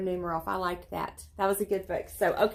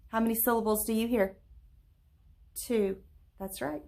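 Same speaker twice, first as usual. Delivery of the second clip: ordinary delivery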